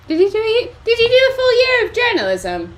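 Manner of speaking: high, loud call to the next room. Real, not acted. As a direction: call out to someone far off. Calling across to Stephen.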